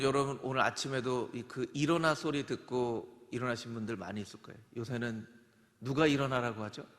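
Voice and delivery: normal talking voice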